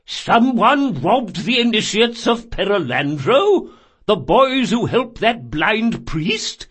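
slow, high, loud indignation; outraged fat man. Acted. Fat man hearing of the robbery, slow outraged voice. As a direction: draw out each word in outrage.

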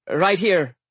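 loud, high quick reply; calls out his dish; Alex answering. Acted.

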